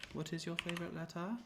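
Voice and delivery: put-on British accent, lifted at the end. Shown as a demonstration. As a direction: imitate a British speaker asking a question. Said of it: "with a British accent".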